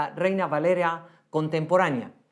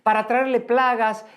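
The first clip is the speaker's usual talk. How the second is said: usual speaking voice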